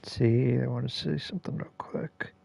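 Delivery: soft unvoiced whisper. Real, not acted. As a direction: whisper it under the broadcast. Quietly aside during live broadcast.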